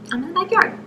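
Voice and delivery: small, high, very soft voice; timid little acted answer; meek repeat. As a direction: say it tiny and high, rising at the end. Meek repeat to angry dad.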